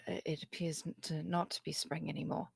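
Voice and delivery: usual speaking voice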